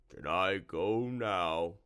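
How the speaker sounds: a slow, oozing monster voice; a lightly voiced slime monster, unhurried; slow slimy creature. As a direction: drawl the answer like a swamp creature. Slimy asks to leave.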